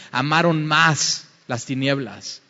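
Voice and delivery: ordinary talk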